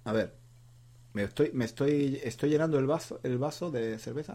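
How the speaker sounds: normal talking voice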